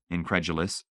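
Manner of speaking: ordinary delivery, nothing marked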